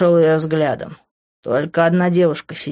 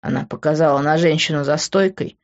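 ordinary delivery

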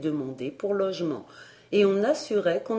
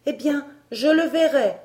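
put-on high, louder vexed cry of a girl; vexed outcry; 8 semitones higher, 5 dB louder than usual. A narrator's reading. Elsie, vexed at the refusal, cries out high and loud. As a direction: cry out in spite. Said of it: "s'écria Elsie dépitée".

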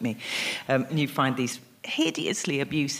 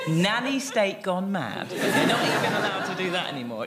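higher-pitched, parodied indignation; mock outrage; 5 semitones higher, 4 dB louder than usual. Troll complaining about lost freedoms, in mock outrage. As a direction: imitate an aggrieved complainer, drawing out the catchphrase. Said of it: "It's this sort of outrage".

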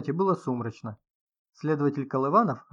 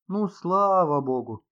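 loud, high, drawn-out exclamation; exclaiming; 5 semitones higher, 6 dB louder, slower than usual. A narrator's reading. Inga answers the writer, exclaiming loudly. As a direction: exclaim it slowly, with raised voice. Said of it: "воскликнула Инга".

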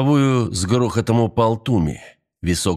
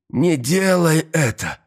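high, drawn-out plea; desperate shout; 7 semitones higher, slower than usual. A narrator's reading. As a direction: cry it high and slow to stop her. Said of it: "крикнул Туми".

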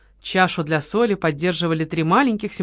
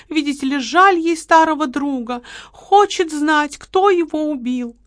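sarcastic mimicry; 8 semitones higher, 3 dB louder than usual.

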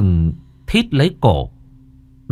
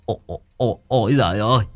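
terrified howl; 4 semitones higher than usual. A narrator's reading. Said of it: "mồm lão rú lên".

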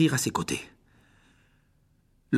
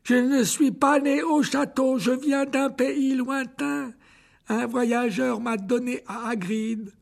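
high, slow creature voice, calmer now; old spider telling its past; 9 semitones higher than usual. Acted. Aragog tells Harry how Hagrid raised him, slow and high. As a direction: tell it as an ancient spider remembering.

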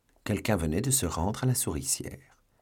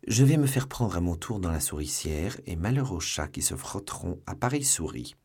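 usual speaking voice

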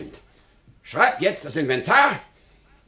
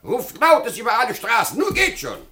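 high, emphatic urging; excited command; 9 semitones higher, 3 dB louder than usual. Acted. Master ordering servants to cry his death in the streets, excited command. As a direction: send them off with gleeful urgency.

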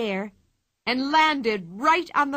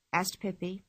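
usual speaking voice

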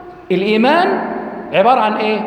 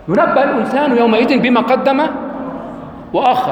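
Quran recitation; 3 semitones higher than usual. Acted. Teacher recites verse.

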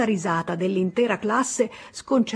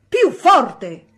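teacher shouting; 6 semitones higher, 10 dB louder than usual. A narrator's reading. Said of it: "gridò l'insegnante".

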